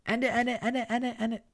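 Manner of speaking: very high, shrieking chant; hysterical shriek. Acted. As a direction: she finally snaps completely. Karma breaking down.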